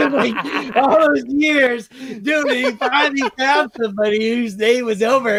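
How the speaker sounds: laughing. Real, not acted. Still laughing telling the punchline.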